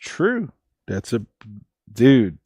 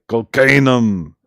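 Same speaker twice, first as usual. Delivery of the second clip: imitating Arnold; 3 dB louder, slower than usual. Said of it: "he's like".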